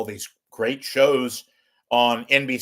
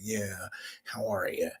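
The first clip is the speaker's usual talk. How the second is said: very soft mannered actor's voice; soft, put-on small talk; John Malkovich; 3 semitones lower, 10 dB quieter than usual. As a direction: murmur it as a quiet impression. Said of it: "I'm John Malkovich".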